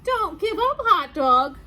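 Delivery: loud, high cheering on; urgent encouragement. Acted. Reader urges a story character not to quit, loud pleading encouragement. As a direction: urge the little hero on, loudly.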